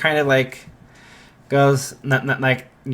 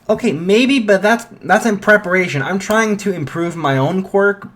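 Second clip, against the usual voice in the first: defensive; 6 semitones higher than usual. Retold or imitated. Izuku defending himself to Bakugo.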